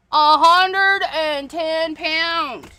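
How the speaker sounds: "A hundred and ten pound" drawn out very slowly, each word weighed; slow emphasis. Real, not acted. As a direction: stretch it out to stress how heavy it is.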